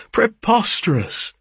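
high-pitched outburst of disbelief; scandalised, high exclamation; mild mock shriek. A narrator's reading. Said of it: "shrieked Fergus".

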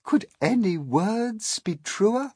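delighted exclamation, high and drawn out; applauding, high cheer; cheering cry. A narrator's reading. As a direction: cry it out slowly in high-pitched agreement. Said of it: "clapped and cheered and cried".

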